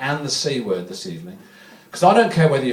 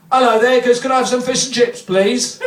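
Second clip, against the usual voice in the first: higher, brighter voice of a muddled customer; confused man; 7 semitones higher than usual. Retold or imitated. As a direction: ask sweetly as if at a chip shop.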